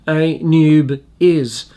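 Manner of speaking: A noob is, slowly as "AY-NYOOB-IZ"; careful pronunciation. Shown as a demonstration. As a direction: deliberately sound out A noob is as one slow name. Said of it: "it's pronounced".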